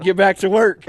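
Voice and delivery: gruff order, acted out; drill sergeant's order. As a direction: dismiss a recruit like a sergeant.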